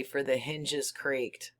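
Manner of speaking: ordinary delivery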